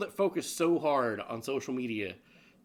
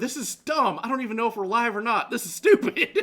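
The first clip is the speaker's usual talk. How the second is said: higher and louder, rushing, fed-up rant; mock frustrated outburst; 6 semitones higher, 7 dB louder than usual. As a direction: act out a hammed-up stream meltdown. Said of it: "and we're like".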